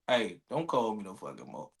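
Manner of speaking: low, quiet deadpan brush-off; mock cold text. A man reading his cousin the brush-off text, low and quiet. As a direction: read the text flat and final.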